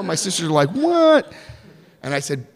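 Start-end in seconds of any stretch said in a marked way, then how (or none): 0.7-1.3 high, loud baffled yelp; stunned high what; shocked what. Retold or imitated. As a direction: squeak it out in disbelief. Sisters baffled.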